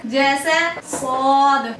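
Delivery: सौद drawn out very slowly and thick; teacher demonstrating a letter; extra-heavy letter demo. Shown as a demonstration. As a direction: make सौद as heavy as you can. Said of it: "और मोटा करके पढ़ेंगे".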